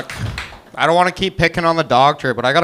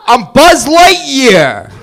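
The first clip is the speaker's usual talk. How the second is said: put-on loud, slow, higher New York accent yell; brash New York accent shout; New York Buzz Lightyear; 11 semitones higher, 9 dB louder, much slower than usual. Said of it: "Toy Story if it was in New York".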